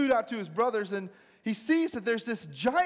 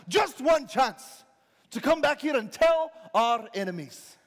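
rousing movie speech, building louder; William Wallace; 3 semitones higher, 4 dB louder than usual. Acted. Rider rallying a retreating army, impassioned. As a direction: drive the troops on with spaced-out phrases. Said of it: "William Wallace rides up on his horse".

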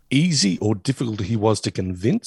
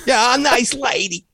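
high Frink voice; 11 semitones higher than usual. Retold or imitated. Frink voice quote.

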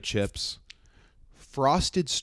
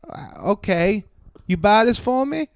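put-on high, loud accented shopper voice; high put-on woman's request; imitating woman customer; 10 semitones higher, 7 dB louder, slower than usual. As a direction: mimic her trying once more, drawn out. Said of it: "And she goes".

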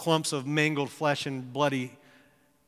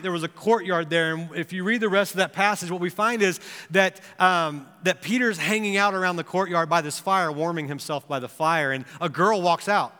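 ordinary talk, nothing marked

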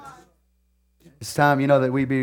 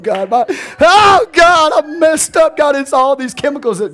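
mock anguished prayer; 10 semitones higher, 6 dB louder than usual. Preacher mimicking a believer blaming chemicals, mock loud wail. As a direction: cry out to God in mock despair.

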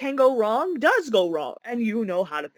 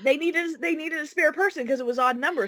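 ordinary talk

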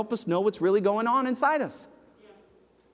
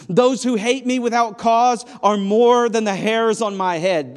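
louder, pleading scripture read; impassioned psalm reading; 4 semitones higher, 7 dB louder than usual. Acted. Preacher reads King David's cry for rescue, pleading and loud. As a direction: read it as a drowning man's plea.

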